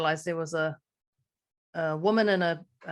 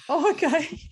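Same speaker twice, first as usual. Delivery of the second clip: taken aback; 8 semitones higher, 5 dB louder than usual. Retold or imitated. Taken aback by the wine reply.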